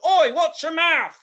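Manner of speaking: high, loud, slow British scold; British bus driver. Retold or imitated. Bus driver telling kid off, loud British scold. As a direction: bark it like an English driver. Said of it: "the bus driver's".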